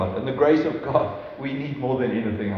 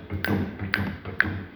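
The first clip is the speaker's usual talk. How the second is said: heartbeat sound; 6 semitones lower, 7 dB quieter than usual. Acted. Heart of God beating.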